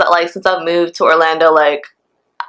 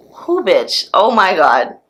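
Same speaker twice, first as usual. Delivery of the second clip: usual speaking voice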